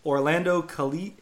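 ordinary talk, nothing marked

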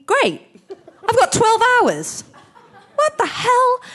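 high, loud comic alarm; exasperated outburst, played for laughs; mock panic. Retold panic at last-minute accent.

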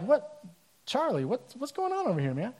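high, baffled calls to a servant; high, startled brother asking what's going on; surprised. Acted. Older brother surprised by party.

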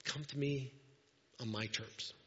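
quiet gentle Jesus. Retold or imitated. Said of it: "he's like".

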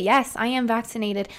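ordinary talk

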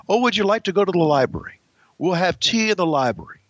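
hammed-up genteel British accent; mock British accent. Shown as a demonstration. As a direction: invite them to tea in put-on British. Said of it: "So that's how you pronounce it".

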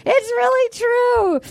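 high, slow, wheezing laugh-cry; laughing hard. Real, not acted. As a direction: squeal it through helpless laughter. Cracking up at tweet.

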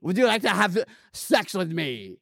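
hammed-up Austrian Schwarzenegger accent; a deliberately bad Arnold come-on; terrible Schwarzenegger. Boss propositioning his maid in a bad Austrian accent. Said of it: "I'm doing a terrible Schwarzenegger".

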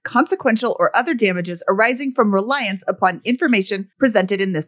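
loud rapid legal read; fast legal disclaimer. Acted. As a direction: rush through the fine print.

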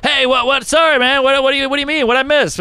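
high, flustered rush. Retold or imitated. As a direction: scramble to cover yourself. Flustered answering Seinfeld.

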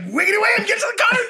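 very high-pitched, frantic character call; manic gunner. Acted. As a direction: holler wildly over the gunfire. Gunner character calls party to cart.

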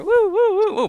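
squeaky high whoops; high woo-woo cries. Retold or imitated. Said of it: "You're like".

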